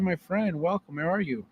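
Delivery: usual speaking voice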